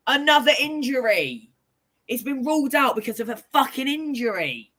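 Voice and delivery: exasperated rant. Real, not acted. Angry at the injury ruling.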